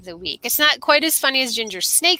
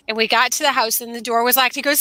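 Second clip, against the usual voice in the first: usual speaking voice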